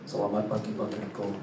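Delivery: very low, soft, formal greeting; hushed, low-pitched executive voice; suddenly dignified new commissioner. Acted. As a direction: greet like a newly important official. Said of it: "Tiba-tiba beda ya".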